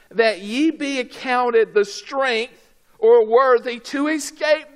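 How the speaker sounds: emphatic, measured verse reading; scripture reading. Shown as a demonstration. Preacher reads the verse to the congregation, emphatic. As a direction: read the verse out firmly, slowly at first.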